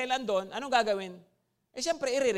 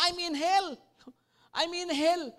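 raised, higher cry of a man in torment; retold scream; 6 semitones higher than usual. Revived man crying out to the doctor, a mild mock scream. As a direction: call out that you're in hell, not full force. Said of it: "so sumigaw yung tao".

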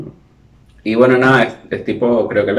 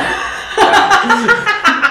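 high laugh; 4 dB louder than usual. Real, not acted. Laughing at the mask story.

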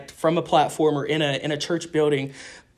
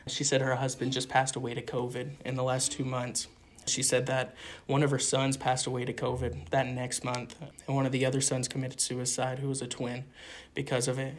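softly saddened; 2 semitones lower, 9 dB quieter than usual. Real, not acted. Telling the host about a widow's losses, quietly saddened. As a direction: voice sinking much softer over each loss. Grim story of loss, voice drops.

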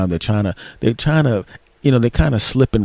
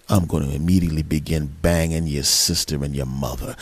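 low, taunting phone threat; imagined villainous boyfriend; 6 semitones lower, 3 dB quieter than usual. Retold or imitated. Boyfriend as she must have heard him, villainous. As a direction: lower the voice and gloat. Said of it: "What she must have heard was".

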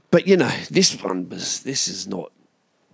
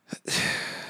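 weary sigh; 9 dB quieter than usual. Real, not acted. Weary sigh after the rant.

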